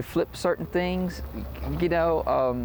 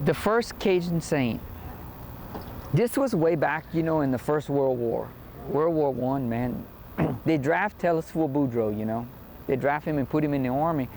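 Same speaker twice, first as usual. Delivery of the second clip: put-on Cajun accent. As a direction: tell it like a Cajun joke. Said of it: "when I tell the Boudreaux and Thibodeau jokes".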